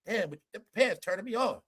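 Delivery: mock flirty coworker. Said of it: "the guy says".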